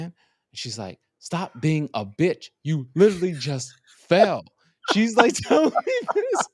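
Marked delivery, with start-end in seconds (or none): 1.2-4.4 retold sisterly scolding, higher and louder; mock scolding. Sister telling brother to get up off the ice, mock scolding. 4.9-6.5 high, laughing incredulity. Real, not acted. Telling a friend how sister scolded the fall, laughing.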